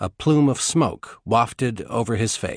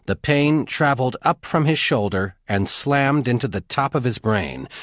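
usual speaking voice

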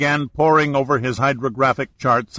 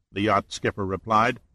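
plain reading voice

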